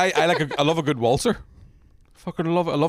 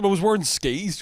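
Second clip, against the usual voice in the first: usual speaking voice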